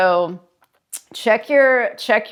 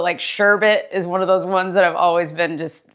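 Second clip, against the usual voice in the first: normal talking voice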